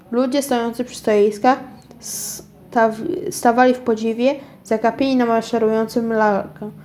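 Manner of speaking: plain reading voice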